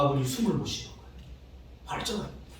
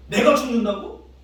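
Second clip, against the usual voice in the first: slow, louder, higher-pitched disbelieving question; acted denial; 8 semitones higher, 6 dB louder than usual. Patient told he is dying, acted disbelief. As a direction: imitate someone refusing to believe it. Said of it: "첫 단계는 부정하는 거예요".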